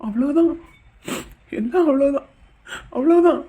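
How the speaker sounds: tearful lament, breaking into a sob at the end; crying. Acted. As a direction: whimper it out, starting to cry. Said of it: "எதுக்கு பாப்பா இப்ப அழுகுற". (அழுதுகொண்டே புலம்பல்)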